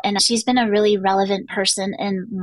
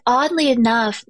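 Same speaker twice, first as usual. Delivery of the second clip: normal talking voice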